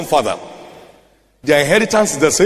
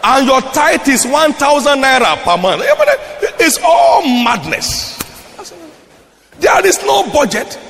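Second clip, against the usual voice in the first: high, loud pulpit outcry; full-force preaching; 8 semitones higher, 3 dB louder than usual. Real, not acted. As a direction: cry out against the madness.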